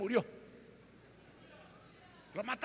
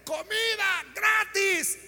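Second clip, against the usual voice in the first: excited shout; 7 semitones higher, 6 dB louder, much slower than usual. Retold or imitated. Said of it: "la gente dice".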